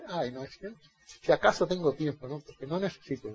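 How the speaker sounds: soft, careless brush-off; imitating a believer who can't be bothered; mock lazy excuse. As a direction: shrug it off lazily.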